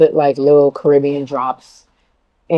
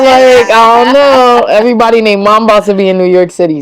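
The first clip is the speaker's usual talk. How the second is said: higher and louder, hyped up; excited; 7 semitones higher, 6 dB louder than usual. Real, not acted. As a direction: hype it up like big news. Hyped about [name] concert.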